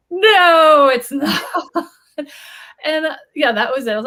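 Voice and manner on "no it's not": laughing denial. Retold or imitated. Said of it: "And I was like".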